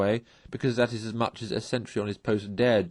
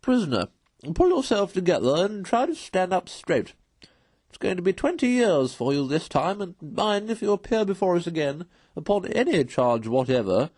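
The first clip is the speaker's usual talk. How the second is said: higher, louder judge pronouncing sentence; a pompous magistrate, louder and higher, scolding; stern magistrate; 6 semitones higher, 4 dB louder than usual. A narrator's reading. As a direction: lay down the sentence from the bench, sternly. Chairman sentences the prisoner.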